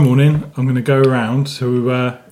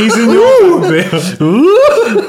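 high, slow, loud laughing drawl; laughing tease; 13 semitones higher, 7 dB louder, much slower than usual. Acted. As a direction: stretch it out, cracking up. Teasing, drawn out.

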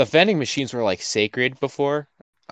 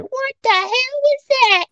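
1 imitating a lunch lady; slower than usual. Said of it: "you hear".

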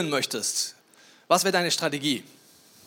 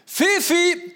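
high-pitched, raised call; calling the dog; 10 semitones higher, 5 dB louder, much slower than usual. Acted. Owner calling the dog Fifi, high-pitched call. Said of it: "Wenn du mit ihm redest, sagst".

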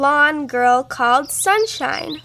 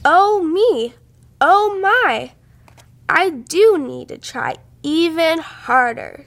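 ordinary delivery, nothing marked

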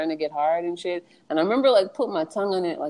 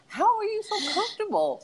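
incredulous; 14 semitones higher than usual. Retold or imitated. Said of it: "His face is just all like".

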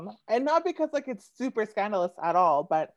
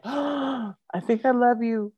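high, gasped lovestruck confession; mock swoon; 7 semitones higher than usual. Poet relives kiss with groomsman, mock lovestruck swoon. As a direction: swoon it, pitch lifted, as if smitten.

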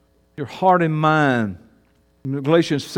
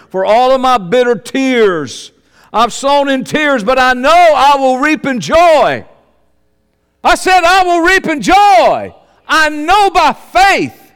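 high, loud, slow preacher's cry; full-force preaching; 9 semitones higher, 10 dB louder, slower than usual. Acted. Preacher to congregation, a loud, slow, soaring cry. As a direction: belt it out to the congregation.

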